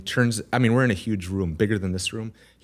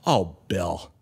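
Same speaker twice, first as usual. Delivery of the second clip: imitated sigh of disappointment, pitched lower; disgusted mentor; 4 semitones lower than usual. Guest imitating mentor whose head drops at his excuse, disgusted. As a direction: let the name sink with disgust. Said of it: "Just disgusted".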